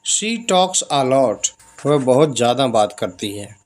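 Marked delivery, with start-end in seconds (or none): 0.1-1.5 each English word spaced out slowly; slow dictation. Shown as a demonstration. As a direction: read it slowly for the learners. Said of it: "तो क्या होगा?".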